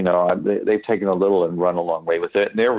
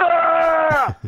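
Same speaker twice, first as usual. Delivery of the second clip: defiant high shouted death scream; fuck you death scream; 4 dB louder than usual. Shown as a demonstration. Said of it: "fuck you death scream".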